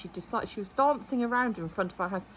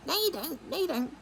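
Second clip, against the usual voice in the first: mocking neighbour; 8 semitones higher than usual. Mimicking neighbour's taunt.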